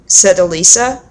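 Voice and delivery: ordinary delivery, nothing marked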